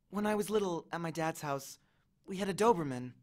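soft, tender confession; tearful. A narrator's reading. Annabeth remembers her dad's house, softly tearful. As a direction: recall it quietly, near tears. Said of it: "there were tears in her eyes".

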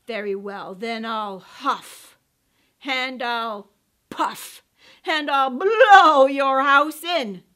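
slow, building to a roar; big bad wolf. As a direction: threaten slow then bellow. Wolf threatens.

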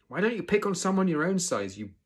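high, loud indignant challenge; angry snap. A narrator's reading. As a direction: snap at the bullies, slowing on the insult. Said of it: "snapped Hiccup at the bullies".

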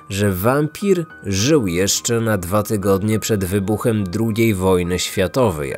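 plain reading voice

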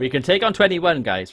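high, very loud battle rally; mock rallying shout. Gamer rallying outnumbered troops to take on twenty-one, mock rallying shout. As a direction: rouse the troops with a playful yell.

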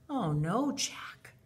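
normal talking voice

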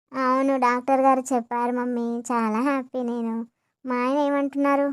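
weak new mother. Acted. New mother answering her mother-in-law, low and soft from exhaustion. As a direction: speak drained after giving birth.

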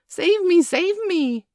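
high, loud desperate plea for help; fleeing boy shrieks to his friends; terrified cry. A narrator's reading. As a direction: scream for rescue from the wolves. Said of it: "cried Nibs".

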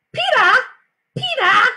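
put-on loud, high toddler shout; little boy calling. A narrator's reading. Little brother calling Peter from the jungle gym, high shout. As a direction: call out as an excited little kid. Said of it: "until he called".

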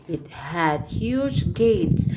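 slow, careful read-aloud for the class. Shown as a demonstration. Teacher rereads the story slowly for the class, slow and careful. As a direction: read it again slowly, word by word. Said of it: "we have to read again slowly".

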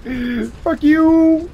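high shrieking taunt; triumphant shriek. Real, not acted. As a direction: crow over the beaten boss. Triumphant after beating boss.

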